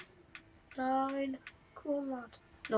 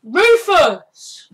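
calling the dog; 8 semitones higher, 12 dB louder than usual. Real, not acted.

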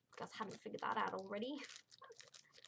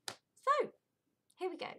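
excited; 9 semitones higher, 6 dB louder than usual. Real, not acted. Kicking off the bag tour.

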